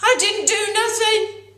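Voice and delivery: high, loud whiny schoolboy protest. Acted. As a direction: plead your innocence to the teacher. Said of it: "Podgy protested".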